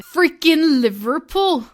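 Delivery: slow, drawn-out attempt at a Liverpool accent. Vlogger naming the cities she visited, putting on a Liverpool accent. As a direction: put on a playful Liverpool accent. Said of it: "shouldn't do that accent".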